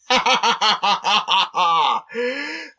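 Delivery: wild maniacal cackle; horrible laugh. Acted. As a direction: cackle like a raging goblin. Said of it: "laughing horribly".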